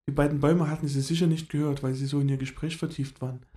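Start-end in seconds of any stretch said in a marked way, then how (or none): none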